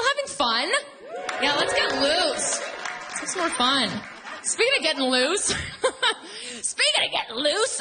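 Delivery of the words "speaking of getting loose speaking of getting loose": laughing. Real, not acted. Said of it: "laughing while talking".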